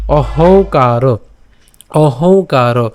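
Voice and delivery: অহংকার drawn out very slowly, o-hong-kar; slow nasal অহংকার; slow careful pronunciation. Shown as a demonstration. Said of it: "কেন".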